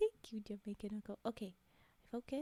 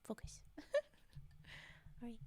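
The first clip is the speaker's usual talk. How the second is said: ordinary delivery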